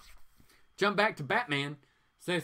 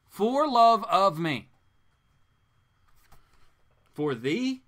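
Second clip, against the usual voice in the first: louder, higher, slow swoon; mock swooning; 4 semitones higher, 8 dB louder, slower than usual. Mocking the villain's line, he swoons it out loud and slow. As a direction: ham up the lovestruck line.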